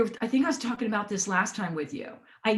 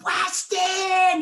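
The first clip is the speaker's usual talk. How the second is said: shouting her name with huge energy; booming excited greeting; 9 semitones higher, 5 dB louder than usual. Retold or imitated. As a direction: call out the name like an old friend. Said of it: "you know, in his style".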